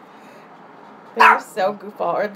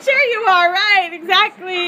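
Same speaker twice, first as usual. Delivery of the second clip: high, loud laughing agreement; 8 semitones higher, 8 dB louder than usual. Real, not acted. Laughing as she echoes her friend's joke. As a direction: laugh along with the tease.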